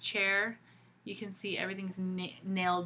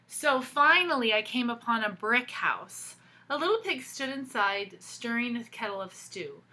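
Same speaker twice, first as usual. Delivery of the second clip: put-on lively read-aloud for kids; storybook reading; 4 semitones higher, 8 dB louder than usual. A narrator's reading. Teacher reading a picture book to children, animated storytelling. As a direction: tell the story to the class with expression.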